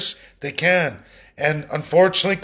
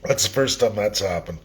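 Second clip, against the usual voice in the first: hoarse, throat catching after coughing; rough throat, frog in the throat; 6 semitones lower, 4 dB quieter than usual. Real, not acted. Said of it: "Totally got a frog in the throat".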